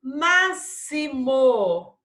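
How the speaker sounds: "Máximo" loud and very slow, ma-si-mo. Shown as a demonstration. Teacher showing pupils double S, "Máximo" slowly sounded out. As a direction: stretch "Máximo" with a clear s. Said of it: "palavrinhas com som de dois S".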